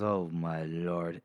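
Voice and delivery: soft, low, slow "Oh, my lord"; hushed awe. Retold or imitated. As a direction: breathe it out, humbled. Glokta awed receiving the ring.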